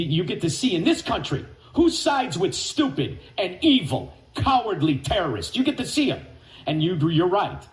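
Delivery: ordinary delivery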